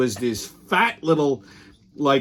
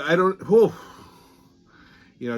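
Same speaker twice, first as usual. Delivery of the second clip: ordinary talk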